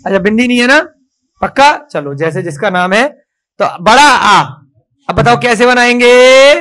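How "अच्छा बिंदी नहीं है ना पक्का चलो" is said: ordinary talk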